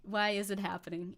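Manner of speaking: ordinary talk, nothing marked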